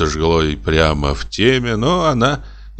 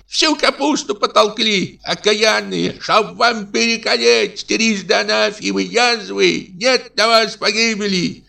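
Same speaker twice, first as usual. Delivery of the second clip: high shrill scolding hag; shrill old woman yelling. A narrator's reading. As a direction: screech curses at the geese like a granny. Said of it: "знакомый визгливый голос".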